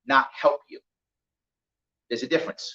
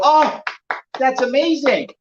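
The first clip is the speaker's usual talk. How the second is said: delighted donors; 7 semitones higher, slower than usual. Retold or imitated. Donors react to a success story on stage, gushing. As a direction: gush with mock delight.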